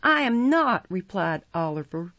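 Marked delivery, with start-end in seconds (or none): 0.0-0.8 high, loud denial; greatly alarmed. A narrator's reading. Oliver answering his accusers, alarmed denial. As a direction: deny it in alarm. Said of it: "greatly alarmed".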